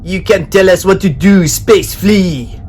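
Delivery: captain mocking Ed; pirate captain. A narrator's reading. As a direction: sneer at the boy as the captain. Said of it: "said the captain".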